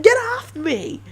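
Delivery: high, loud, hammed-up yelling for rescue; mock panicked cry for help. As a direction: play a listener begging to be saved, overacted. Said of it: "And you're like".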